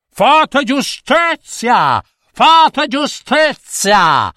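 high, slow, commanding yell; king shouting orders. A narrator's reading. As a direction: roar at the guards for justice. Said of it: "aveva voglia Re Tuono di gridare alle guardie". (il re grida alle guardie, voce alta e lenta)

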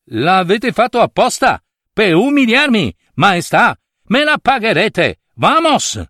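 high, indignant protest with a sharp exit; offended Spanish prince. Acted. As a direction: threaten the king in a high, wounded voice. Humiliated Spanish prince storms off.

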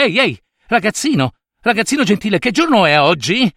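put-on high, excited hail to a boy in the street; eager calling out. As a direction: call him over, bursting with excitement. Scrooge chiama il ragazzino. (richiamo allegro ed eccitato)

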